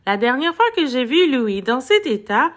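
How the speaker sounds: plain reading voice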